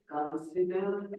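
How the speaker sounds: slow, soft, drawn-out word; mock slowing right down for a listener; exaggerated slow speech. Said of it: "I am speaking very fast".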